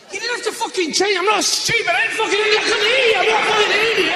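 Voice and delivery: high-pitched, exasperated outburst; hammed-up high tirade; mock indignant rant. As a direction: squawk back as if insulted. Acts out indignant misheard audience member.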